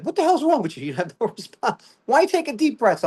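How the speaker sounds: high-pitched voice of a puzzled bystander; baffled guy. Acted. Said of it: "the guy's like".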